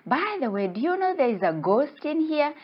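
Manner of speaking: high little-fish voice; little guy. Acted. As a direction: play a small creature breaking news. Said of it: "this little guy say".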